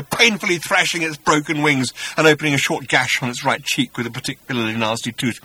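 ordinary delivery

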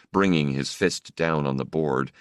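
plain reading voice

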